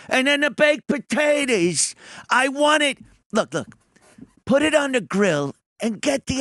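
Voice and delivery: Dice ordering. Retold or imitated. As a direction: order for the whole table. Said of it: "he's doing the whole".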